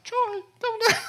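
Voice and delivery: high, squeaky alarmed "Troy, don't"; panicked plea. Retold or imitated. Telling his buddy Troy not to approach, panicked high squeak. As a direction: yelp it like you see trouble coming. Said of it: "I'm like".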